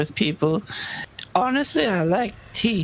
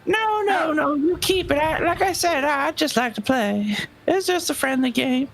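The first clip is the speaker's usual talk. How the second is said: put-on high sly old lady voice; raspy sly old gambler; 10 semitones higher than usual. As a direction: gloat sweetly like a sly granny. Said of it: "Granny refuses to take it from you".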